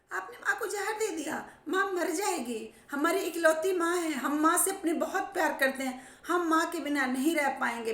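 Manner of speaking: high wailing accusation; crying shout. Retold or imitated. Children crying out at father who poisoned their mother. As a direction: wail it out, near tears. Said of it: "चिल्लाने लगे, चीखने लगे".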